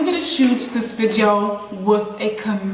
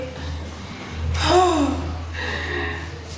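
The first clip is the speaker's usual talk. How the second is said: weary sigh; 4 semitones higher, 6 dB quieter than usual. Real, not acted. Coaxing the chameleon to cooperate.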